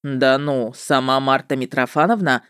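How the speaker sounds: низкий голос ведьмака со скрытой обидой; протяни пониже, будто она успела насолить; протяжно, со сложным выражением. A narrator's reading. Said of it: "С каким-то сложным выражением протянул он". (протяжно)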